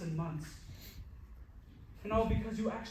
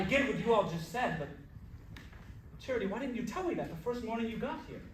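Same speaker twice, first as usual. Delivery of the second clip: ordinary talk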